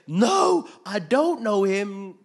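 high, full-volume outburst; shouted denial. Acted. Cornered Peter denying Jesus to accusers, drawn-out high shout. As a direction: yell it, stretching out the words.

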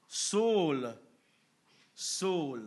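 loud, booming call from heaven; voice of the Lord calling. Acted. Preacher voicing the Lord calling out to Saul, loud and commanding. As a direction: call his name twice like God from above.

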